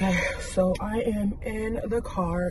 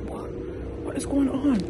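surprised; 7 semitones higher, slower than usual. Retold or imitated. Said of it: "I was like".

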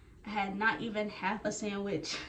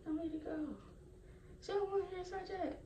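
instructor; 9 semitones higher, 9 dB quieter, faster than usual. Retold or imitated. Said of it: "I think it's like".

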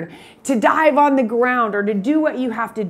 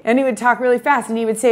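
ordinary talk, nothing marked

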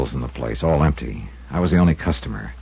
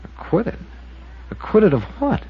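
breathy, much higher double take; stunned; 14 semitones higher, 4 dB quieter than usual. Acted. Investigator stunned by the waitress's news, high breathy disbelief. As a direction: caught completely off guard, asking back.

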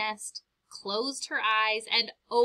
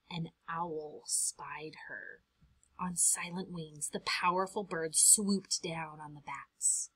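hushed unvoiced whisper; storyteller whispering as the owl swoops; whispered suspense; 3 semitones lower, 8 dB quieter than usual. A narrator's reading. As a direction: whisper it like the owl might hear. Owl attack, read in whisper.

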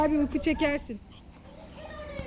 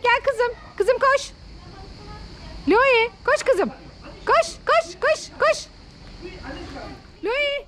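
calling the dog; 6 semitones higher, slower than usual. Real, not acted. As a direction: urge the dog to run over.

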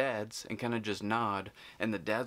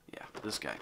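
whisper; 7 semitones lower, 7 dB quieter than usual. Real, not acted. Repeats it, whispered.

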